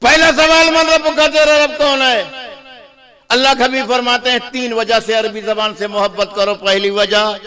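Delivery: high, ringing sermon delivery; full-force preaching. Real, not acted. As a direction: proclaim it to the whole congregation.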